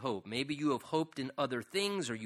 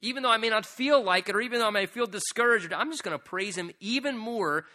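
raised, insistent, stubborn resolve; defiant; 8 semitones higher, 5 dB louder than usual. Acted. Preacher voicing a believer who praises despite discouragement, defiant. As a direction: push it out high with mild defiance. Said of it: "It's almost like this defiant".

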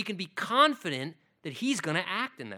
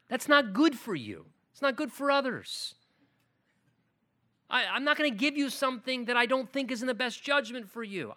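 firm loving father; 6 semitones higher, 8 dB louder than usual. Acted. A father refusing his child's request, loud and firm then gentle. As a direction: lay down the law as a father who loves. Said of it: "Let me try it another way".

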